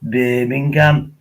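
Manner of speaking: slow, mock Birmingham accent with a long vowel; lightly put-on Brummie accent. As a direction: drawl it out like a Brummie. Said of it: "Jace, he talks like this".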